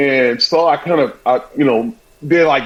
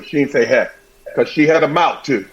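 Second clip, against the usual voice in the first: ordinary talk, nothing marked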